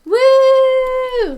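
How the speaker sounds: loud high whoop of joy; excited cheer. Real, not acted. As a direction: cheer it out, full volume. Celebrating end of episode.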